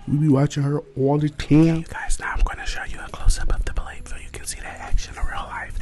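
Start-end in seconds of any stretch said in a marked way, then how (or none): 1.8-5.8 ASMR-style whisper; whispered goodbye to the viewers. Real, not acted. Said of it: "I don't know why I'm whispering".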